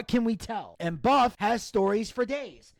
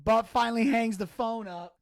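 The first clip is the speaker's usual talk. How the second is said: ordinary talk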